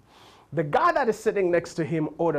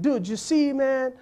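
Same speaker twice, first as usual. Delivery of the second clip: put-on higher, cocky surfer-kid voice; 7 semitones higher than usual. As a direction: play the smug kid sure he's won.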